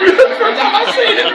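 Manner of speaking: high laughing mock blubber; hammed-up weepy high squeal; Ric Flair crying. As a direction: keep the teary wail going through laughter. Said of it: "And Ric Flair went".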